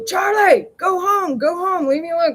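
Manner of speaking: high-pitched shooing call. Retold or imitated. Telling Charlie the street dog to go home, high call. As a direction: re-enact calling sharply to a dog, high.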